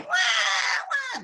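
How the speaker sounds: mock crying. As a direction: wail like the children for laughs. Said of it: "The children were crying".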